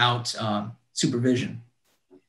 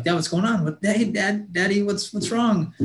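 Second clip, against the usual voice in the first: higher-pitched, anxious questioning; retold worry, pitched up; worried child; 6 semitones higher than usual. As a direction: imitate a worried kid calling Daddy. Child asks crying dad what's wrong.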